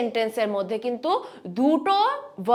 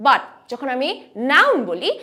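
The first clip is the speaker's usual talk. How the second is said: ordinary delivery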